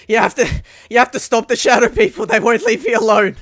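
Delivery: loud, high, desperate cries; frantic pleading. Acted. Game master as terrified woman grabbing a rescuer, frantic. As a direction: beg at the top of your voice.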